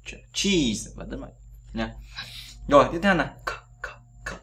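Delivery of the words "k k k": "k" and "g" whispered lightly; just breathe the "k" sounds. Shown as a demonstration. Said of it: "Giống như cái âm nhẹ thôi".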